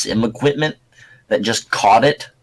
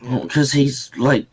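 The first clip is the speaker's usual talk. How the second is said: usual speaking voice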